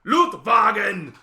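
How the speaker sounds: loud, high, slow German shout; exaggerated, aggressive parody German; mock German. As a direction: bellow it in a harsh German accent. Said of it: "and then there's German".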